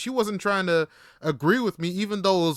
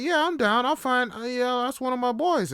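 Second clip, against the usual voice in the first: eager agreement; 9 semitones higher, 3 dB louder than usual. Retold or imitated. Agreeing eagerly to bring friend.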